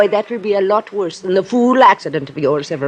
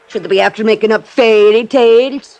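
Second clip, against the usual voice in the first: ordinary delivery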